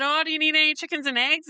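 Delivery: high, bright call to a passerby; eager sales call. Retold or imitated. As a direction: hail him cheerfully. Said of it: "I said".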